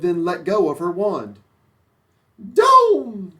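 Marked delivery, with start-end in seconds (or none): 2.4-3.3 high loud command; high loud witch shout; witch's cry. Acted. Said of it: "cried the witch".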